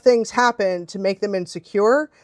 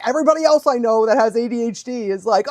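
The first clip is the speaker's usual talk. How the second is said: ordinary delivery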